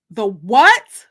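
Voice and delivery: a sudden loud baffled burst; startled question. Acted. Narrator hearing her friend mention the military, loud startled surprise. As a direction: blurt it out, caught off guard.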